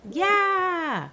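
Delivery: high, loud, slow cheer; excited cheer. Real, not acted. Player cheers a squirrel villager onto her island, excited and loud.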